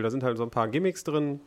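usual speaking voice

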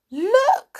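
amazed. Acted. Joe spotting the cloud at the treetop, amazed. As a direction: gasp it out in amazement. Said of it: "said Joe in amazement".

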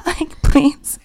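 high breathy whispered plea; whispered please. Pretend. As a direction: beg in a whisper. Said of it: "whispered plea".

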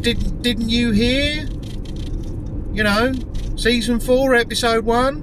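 slow, high disbelieving question; milk each word like he's slow; incredulous, drawn out. Retold or imitated. Retelling his own disbelief to a mate, high and slow.

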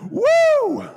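very high, loud woo; ecstatic whoop. Real, not acted. Preacher celebrating Jesus' words to Peter, with a very high, loud whoop. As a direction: shout out in pure excitement.

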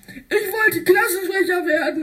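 high, laughing mock wail; hammed-up crybaby whine; mock crying. Spike losing the election, high mock wail. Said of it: "Spike heulte".

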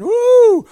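a loud, high whoop of joy; excited woo. Real, not acted. Preacher whooping to the congregation, high and loud. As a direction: let the spirit lift a whoop out of you.

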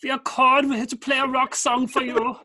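hammed-up German accent, high and loud; mock German accent. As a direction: play a German rock band intro in a jokey German accent. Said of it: "this is an appropriate accent".

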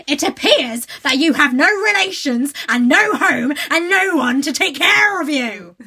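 loud, slow, cold scolding; temper rising. A narrator's reading. Headmistress lecturing the orphaned girl: loud, rising temper. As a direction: lay it out coldly, anger building. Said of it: "her temper rising".

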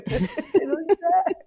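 laughing through the words, high and loud. Real, not acted. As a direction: burst out laughing mid-phrase. Laughs mid-sentence.

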